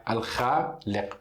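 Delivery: slow "Al-Khaliq" with a rasping kh and a deep q; Arabic pronunciation. Shown as a demonstration. Teacher pointing at the letter Kha, slow and careful. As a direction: spell out "Al-Khaliq" slowly, pressing the throat sounds. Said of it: "Aqui letra Kha".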